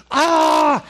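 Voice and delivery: sudden high, loud scream of fright. Retold or imitated. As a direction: shriek like a kid in Home Alone. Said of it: "And I'm screaming".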